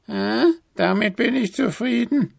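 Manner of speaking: hohe, genüssliche Altweiberstimme; zufriedene Alte. A narrator's reading. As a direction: zufrieden zustimmen. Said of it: "antwortete die Alte".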